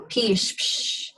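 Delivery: "pish" ending in a long voiceless sh; "pish, psh" with a hushing end; SH sound. Shown as a demonstration. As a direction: draw out the sh of "pish", then just "psh". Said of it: "It's a sh sound".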